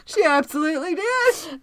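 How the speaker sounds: co-teacher confirming while laughing, high and loud; laughing confirmation. Retold or imitated. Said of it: "She said".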